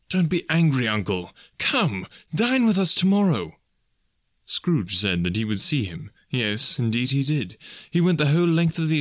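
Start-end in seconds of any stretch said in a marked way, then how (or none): 0.1-3.6 coaxing invitation. A narrator's reading. Nephew coaxes uncle to dinner.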